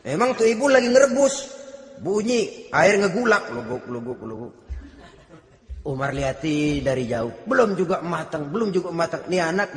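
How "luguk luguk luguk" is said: soft bubbling "luguk" noises; boiling water sound. Retold or imitated. Preacher imitates the pot the mother boils, soft bubbling sounds. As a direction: mimic a pot bubbling, quietly.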